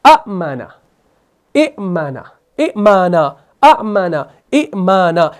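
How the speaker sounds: amana and imana read with a short opening vowel; अमाना and इमाना each said with a short clipped first vowel; original short forms. Shown as a demonstration. Teacher demonstrating the original words. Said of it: "jerk के साथ पढ़ते हैं".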